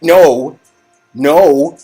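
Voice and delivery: usual speaking voice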